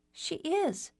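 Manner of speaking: put-on high, girlish voice; light teen girl voice; little sister voice. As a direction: play the cheeky youngest sister.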